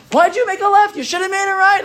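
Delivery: high, nagging whine at the driver; whiny backseat complaint. Retold or imitated. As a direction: pester like a backseat driver who thinks he knows better. We complain to God about directions.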